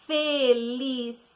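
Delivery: "Felicidade" slowly chopped into fe, li, ci, da, de; teacher counting out "Felicidade" in five separate beats; syllable by syllable. Shown as a demonstration. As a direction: sound out each syllable of "Felicidade", leaning on da. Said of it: "Vamos lá?".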